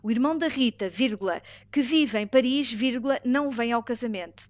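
dictating. Shown as a demonstration. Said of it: "A frase dois é".

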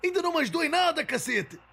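rapid-fire furious outburst; fast angry rant. Acted. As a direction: blurt it out fast in anger. Frustrated rant at bot.

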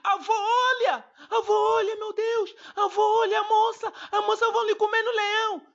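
put-on high, loud panicked child yell; child shouting. Grandson yelling at grandpa about the woman in the lion cage, loud and high. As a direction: shriek at grandpa to look, in a panic. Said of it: "eu gritei".

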